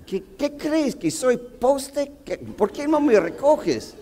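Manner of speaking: agudo e indignado, en tono de reclamo; queja indignada. Retold or imitated. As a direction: reclama al chofer que no se detuvo. Se queja al chofer que no para. (reclamo indignado al chofer)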